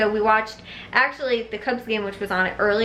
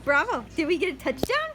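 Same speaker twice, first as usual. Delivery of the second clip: much higher and softer, singsong to the dog; high coaxing dog talk; 9 semitones higher, 8 dB quieter than usual. Real, not acted. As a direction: coo questions at the dog playfully.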